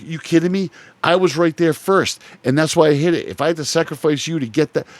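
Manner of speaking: high, loud heated outburst; retold yell at son. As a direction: yell your defence like a furious teammate. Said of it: "I can't yell at my ten-year-old like that".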